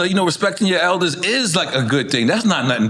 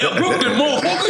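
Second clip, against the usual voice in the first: put-on higher, brash Brooklyn-accented greeting; Brooklyn tough talk; 12 semitones higher than usual. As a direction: toss it off like a rough Brooklyn guy, mock. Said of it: "A Brooklyn person will offend you".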